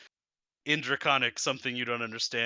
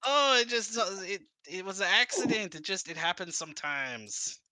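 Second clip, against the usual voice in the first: sheepish NPC; 7 semitones higher than usual. Acted. NPC excusing lightning blast.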